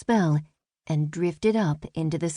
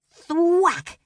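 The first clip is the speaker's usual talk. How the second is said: high, louder thwack sound effect; arrow thwack; 12 semitones higher, 4 dB louder than usual. Acted. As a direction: punch the arrow impact. Arrow hits target.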